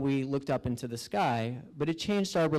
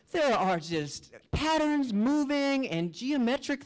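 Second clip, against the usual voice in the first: high, slow, dazzled voice; awestruck wonder; 11 semitones higher than usual. Acted. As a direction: marvel at the visions in a lifted, drawn-out voice. Acting out wonder at the visions.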